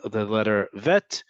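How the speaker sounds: ordinary talk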